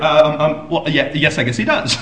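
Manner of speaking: lightly imitated tongue-tied reply, with a laugh; flustered stammering. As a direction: stumble through the reply like someone caught off guard. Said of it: "she stammered".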